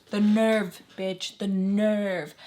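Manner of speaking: loud, drawn-out outrage on "nerve"; hammed-up, slow indignant outburst; loud indignation. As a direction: punch "nerve" hard and stretch it out. Outraged at being accused.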